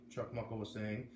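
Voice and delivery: ordinary delivery, nothing marked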